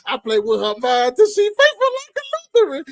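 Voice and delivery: usual speaking voice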